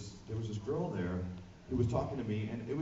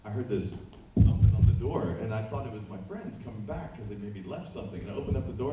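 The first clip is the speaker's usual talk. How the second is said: ordinary talk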